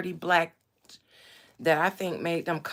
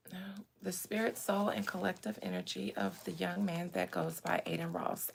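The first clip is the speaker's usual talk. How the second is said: soft, measured invocation; prayer; 6 dB quieter than usual. Real, not acted. Streamer giving thanks to God before channeling, soft prayer. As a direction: open a session with reverent thanks.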